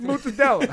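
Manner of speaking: "Mozzarella" said the Italian way, like "mutsadell", high and loud; Italian pronunciation. Shown as a demonstration. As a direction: drop the ending of "Mozzarella" like an Italian grandmother. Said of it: "That's how they say it in Italian".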